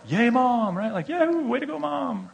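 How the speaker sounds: high, bright mock cheering for mom. Pastor imagining Jesus cheering his mom: high mock cheer. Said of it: "you'd expect Jesus to go like".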